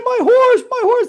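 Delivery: high, loud mock cry; yelling. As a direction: act out a frantic villager yelling for help. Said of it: "And he's yelling".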